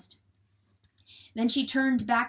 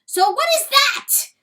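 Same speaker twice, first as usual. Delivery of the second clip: very high, loud outburst; sudden cry; 11 dB louder than usual. A narrator's reading. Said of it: "John Wesley suddenly cried".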